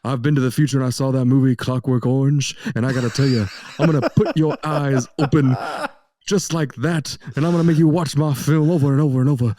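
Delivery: hammed-up [name] picking a bone; [name] impression. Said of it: "[name] is back".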